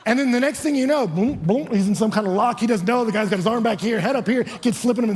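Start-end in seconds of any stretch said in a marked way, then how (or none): none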